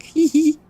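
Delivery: high little "hee hee"; put-on squeaky giggle of delight; gleeful giggle. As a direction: imitate a pleased, high-pitched titter. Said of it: "be like".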